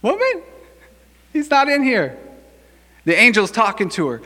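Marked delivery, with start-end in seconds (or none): 0.0-2.2 comically high-pitched angel call; angel addressing woman. Acted. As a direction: sing it out high, mock-announcing. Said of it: "same thing".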